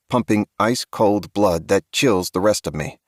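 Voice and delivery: usual speaking voice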